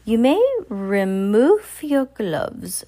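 very slow, loud, high witch command; witch's shout. Acted. Grand High Witch commanding witches, slow shouted order. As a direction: stretch each word like a cruel queen. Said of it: "she shouted".

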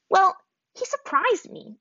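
high, slow indignant protest. A narrator's reading. Azula protests to her snickering brother, indignant. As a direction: defend yourself, offended. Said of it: "Azula protested".

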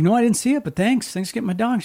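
high, lightly mocked brush-off; a hint of a careless dog owner; dismissive neighbor. Neighbor shrugging off the signs, mild mock voice.

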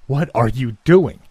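loud, sharp demand from the father; angry demand. Acted. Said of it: "he demanded".

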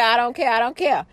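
ordinary talk, nothing marked

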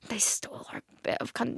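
whispered mock grievance; playful hushed grudge; conspiratorial whisper. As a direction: drop to a whisper like it's a secret. Joking grudge against England.

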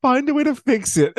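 mocking Molly Ringwald's pep talk, sarcastic; mock advice. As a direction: parrot the useless advice.

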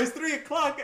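high, startled blurt; alarmed realization. Retold or imitated. New hire who went home too early, alarmed. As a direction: suddenly realize you left early.

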